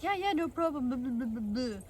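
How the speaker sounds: mimicking him. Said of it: "he's like".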